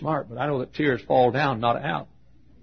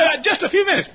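hurried lady; 6 dB louder than usual. Retold or imitated. Pastor voices the hurried lady.

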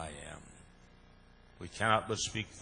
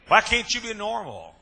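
much higher, loud, falling cry; high exasperated shout; 13 semitones higher, 13 dB louder than usual. Retold or imitated. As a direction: yell it in disbelief at the preacher. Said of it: "He said".

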